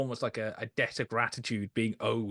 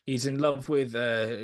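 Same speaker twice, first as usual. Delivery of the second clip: ordinary delivery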